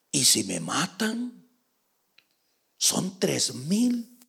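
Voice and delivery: hushed, hesitant objection; softly protest, dragging the last words; small, unsure voice; mild worry. Acted. David's worried objection, acted.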